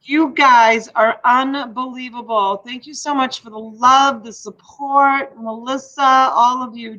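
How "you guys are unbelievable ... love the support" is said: ordinary delivery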